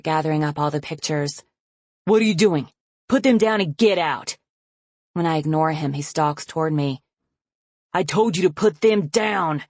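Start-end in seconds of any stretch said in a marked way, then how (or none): 2.0-4.4 loud, higher barked order; father shouting at his daughter to drop the photos; angry shout. Acted. 7.9-9.6 louder, hard warning; father ordering his daughter again; angry command. Acted.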